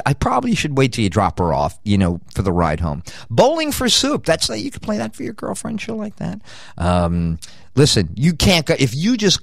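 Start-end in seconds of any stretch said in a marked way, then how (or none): 3.3-6.4 squeaky high. Real, not acted. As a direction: squeak it up high, then trail off. Excited recommendation, squeaky.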